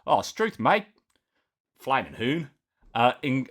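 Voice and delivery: a mock Aussie bloke reading the news badly on purpose; bad Australian accent. Commentator jokingly reads Australian news in a bad Australian accent. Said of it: "incredibly bad, uh, Australian accent".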